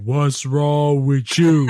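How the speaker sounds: loud, slow, exaggerated Southern drawl; mocking impression of a Southern drawl; mock Southern accent. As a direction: imitate a hammed-up Southern twang, dragged out. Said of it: "I don't talk like that".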